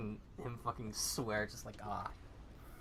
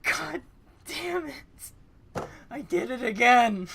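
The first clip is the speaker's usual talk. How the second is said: much higher, loud frantic cursing; frantic yelling; 12 semitones higher, 7 dB louder, slower than usual. Acted. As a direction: yell at yourself in panic. Character screaming in panic.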